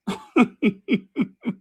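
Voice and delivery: high-pitched, rapid giggle. Real, not acted. Host amused by own bad accent, high giggle. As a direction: giggle in short high bursts.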